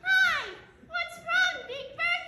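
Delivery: high, loud squeaky puppet voice; Elmo. Acted. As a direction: bounce it like a giddy little red monster. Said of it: "It's me, Elmo".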